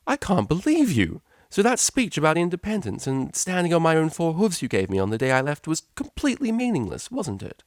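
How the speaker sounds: betrayed and furious. Acted. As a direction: Octavia raging at her mother. Furious daughter confronts mother.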